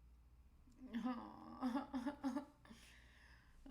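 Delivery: high, rising helpless laugh; disbelieving laugh. Real, not acted. Trader laughing at a wild open, high disbelief.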